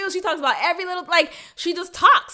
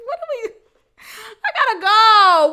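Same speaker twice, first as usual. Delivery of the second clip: laughing; 7 semitones higher, 6 dB louder than usual. Real, not acted. Laughing at own rant.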